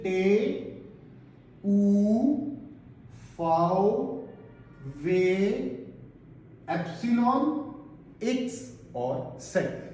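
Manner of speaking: slow, clear letter names for the class to repeat. Shown as a demonstration. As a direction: spell out each letter slowly for students to echo. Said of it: "एक दफा मेरे पीछे". (धीरे-धीरे अक्षर बोलना ताकि बच्चे दोहराएँ)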